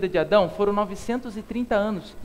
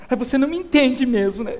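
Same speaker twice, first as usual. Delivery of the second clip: mock-whiny sulk with a laugh; whiny Eva; 3 semitones higher, 4 dB louder than usual. Pastor jokes Eva dodges, giggly whine. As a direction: play a sulky, giggly wife. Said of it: "Brincadeira, não foi desse jeito".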